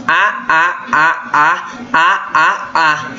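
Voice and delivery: "A, a, a" as separate open A vowels in an even series; repeated vowel demo. Shown as a demonstration. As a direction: tap out the bare vowel "a" again and again, evenly spaced. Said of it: "quando começa essa repetição".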